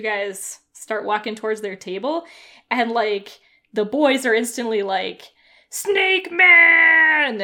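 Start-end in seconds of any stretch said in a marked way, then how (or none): 5.7-7.4 shrill excited shout; excited kids shouting. Acted. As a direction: yell it like thrilled kids.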